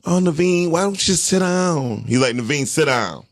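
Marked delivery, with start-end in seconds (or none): 0.0-2.0 higher, pleading mock-gentle request; mock soft-touch coaxing. How the principal would not address the daughter, mock coaxing. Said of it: "He didn't go". 2.3-3.2 firm, direct command; imitated firm principal. Principal telling the daughter to behave, imitated firm voice. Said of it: "her principal voice sound like mine".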